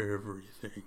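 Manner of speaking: lightly voiced Thanos, low and grave; Josh Brolin voice. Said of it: "he says in very deep Josh Brolin voice".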